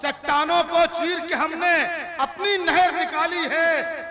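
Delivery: high, loud mushaira recital; declaimed poetry. Acted. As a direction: ring it out to the crowd. Recites verse to audience. (बुलंद आवाज़ में शायरी)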